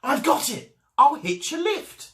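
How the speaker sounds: a tiny snail's excited eureka; excited cry. A narrator's reading. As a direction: cry it out with delight as the idea hits. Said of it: "then cried".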